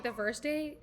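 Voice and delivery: ordinary talk, nothing marked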